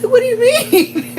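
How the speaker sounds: high, slow, stunned "what?"; mock surprise. To her brother, stunned high disbelief. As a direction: squeak it out in playful disbelief.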